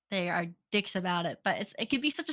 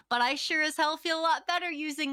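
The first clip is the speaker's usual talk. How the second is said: ordinary talk, nothing marked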